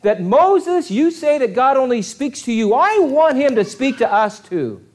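high, loud, indignant crowd complaint; upset people. Retold or imitated. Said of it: "the Hebrew people ... they say".

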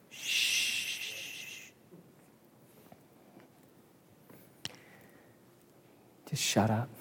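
soft, hushed shushing; hushing crowd. Acted. Crowd telling the blind beggar to hush, quietly. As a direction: hiss the beggar into silence. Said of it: "So what did the crowd say?".

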